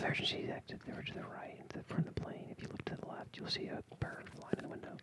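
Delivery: whispered safety briefing; hushed airline announcement; ASMR flight attendant. Pretend. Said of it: "That's actually kind of what it sounds like".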